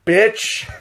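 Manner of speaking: 1 mock jeering tag. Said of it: "J D just tacks on".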